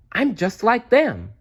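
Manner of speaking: bursting with happy discovery; delighted baby bat. A narrator's reading. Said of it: "thought Stellaluna".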